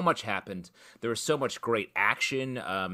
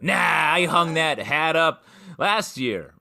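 Patrick Mahomes; 5 semitones higher, 7 dB louder, slower than usual. Retold or imitated. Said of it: "He's like".

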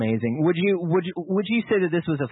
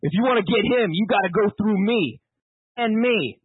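higher-pitched New York movie line; New Yorker accent; 5 semitones higher than usual. Acted. Host setting up the powerful moment, New Yorker accent. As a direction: play a defiant New Yorker, drawing out the challenge. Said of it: "he's a New Yorker".

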